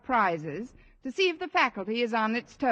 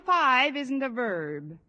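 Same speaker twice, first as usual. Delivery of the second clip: slow realization; much slower than usual. Acted.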